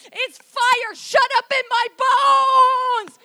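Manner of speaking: very loud, high, drawn-out yell; full-throated preacher's scream; uncontainable shout. Acted. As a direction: cry it out, stretching the last word. Acts out being unable to hold back.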